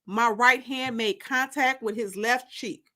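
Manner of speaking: plain reading voice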